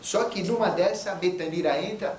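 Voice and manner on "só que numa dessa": ordinary delivery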